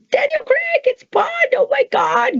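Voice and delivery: very high starstruck squeal; starstruck inner squeal. Retold or imitated. Meeting Daniel Craig, her inner fan squeals very high. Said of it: "going off in my head".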